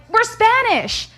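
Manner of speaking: high, loud, slow panicked blurt. Retold or imitated. Cornered by a stranger asking his race, panicked high blurt. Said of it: "panicked, and I was like".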